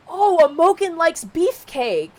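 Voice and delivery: high, loud "oh" of discovery; sudden realization. Acted. Heroine realizes who likes Beefcake, high surprised voice.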